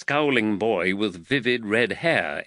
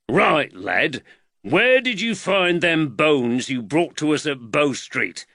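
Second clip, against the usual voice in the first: louder, higher questioning of a lad; stern policeman getting straight to it; constable's demand; 5 semitones higher, 4 dB louder than usual. A narrator's reading. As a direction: confront the boy firmly, voice raised. Gruff constable questions a boy.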